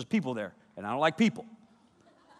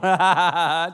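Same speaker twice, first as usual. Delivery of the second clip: real burst of laughter; 6 dB louder than usual. Real, not acted. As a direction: laugh breaking out. Laughs at his own story.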